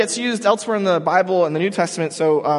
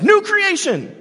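leaps much higher and louder on new creation; excited yell; 5 dB louder, slower than usual. Shown as a demonstration. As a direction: burst out with it. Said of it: "He gets excited ... and he yells".

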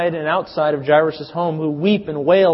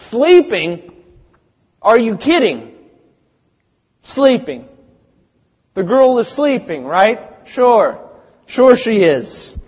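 scornful crowd ridicule, higher and louder; mocking; 5 semitones higher, 4 dB louder, slower than usual. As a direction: sneer it back at him in disbelief. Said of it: "they begin to mock Jesus".

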